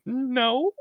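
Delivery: high-pitched little no; mock innocent denial. Jordan denying she shot her, mock innocent denial. Retold obvious lie after shooting.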